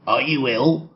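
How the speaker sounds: lightly voiced rat-like snapper; a hint of a prying doorstep photographer; rat man. A narrator's reading. Rat-like photographer quizzing the sick man at his door, lightly put-on voice.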